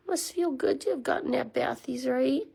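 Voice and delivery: ordinary talk, nothing marked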